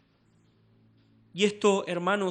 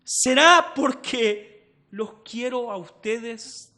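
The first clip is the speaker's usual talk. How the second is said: tender question; 6 semitones higher, 8 dB louder, slower than usual. Retold or imitated. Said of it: "dice Pablo".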